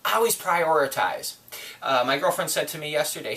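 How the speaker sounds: usual speaking voice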